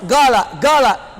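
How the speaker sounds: "gala" twice, each with a hard g for q; Yemeni g. Shown as a demonstration. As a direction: repeat "gala" in Yemeni pronunciation, g not q. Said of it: "Saya dari Yaman".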